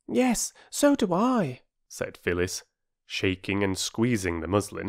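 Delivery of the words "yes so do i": very high, drawn-out agreement; eager girl. Acted. Child Phyllis agreeing eagerly.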